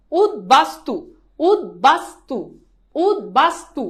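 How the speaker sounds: উদ্বাস্তু as "ud... bas... tu", each piece separate; syllable-by-syllable drill. Shown as a demonstration. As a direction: pause between syllables of উদ্বাস্তু and rise at the end. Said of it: "তাহলে কি হবে বলো?".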